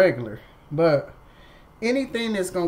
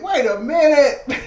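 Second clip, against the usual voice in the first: slow, higher, louder laughing wait a minute; laughing disbelief; 10 semitones higher, 11 dB louder than usual. Retold or imitated. Patient laughing in disbelief at how painless it was, slower and louder. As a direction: laugh through it in astonishment. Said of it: "I said".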